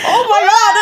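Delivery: high, loud retold gasp of amazement; excited outburst. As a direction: relive the shock, laughing and loud. Acts out own reaction to fight scene.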